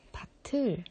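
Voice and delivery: 밭을 said the wrong way; wrong take of 밭을; wrong pronunciation. Shown as a demonstration. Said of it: "이러면 안 됩니다".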